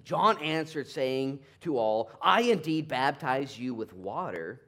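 full-force pulpit proclamation; preaching loud. Acted. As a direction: thunder it out to the crowd. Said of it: "preaching at full force".

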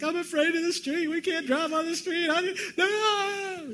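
put-on high, wailing scared child voice; hammed-up frightened sob of a little kid; mock tearful child whimpering about the street; mock crying child. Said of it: "start crying like".

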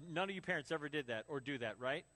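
ordinary talk

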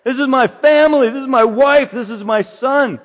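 loud, high, indignant shouting; retold angry outburst. Husband telling police, loud and indignant. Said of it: "I said".

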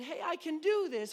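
acted kid perking up; dawning confidence. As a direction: discover mid-stroke that it's working. Child realising they can swim.

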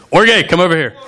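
playfully hailing someone over; mock calling out. Preacher jokingly calling an imagined Swedish guy, playful holler. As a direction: call him over as a joke.

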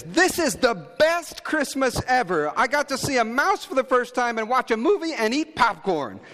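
put-on delighted little girl; high thrilled child voice. Voicing daughter's delight.

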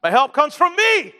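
mock self-reliance. Preacher jokingly misreading the psalm to the congregation, mock self-reliance. Mock wrong answer, followed by laugh.

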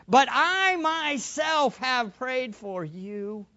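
slow, leaning on "myself"; stress on myself. Shown as a demonstration. Preacher stressing "I myself" for the congregation, slow and weighty. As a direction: draw out "I myself" slowly with weight. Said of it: "you could translate it".

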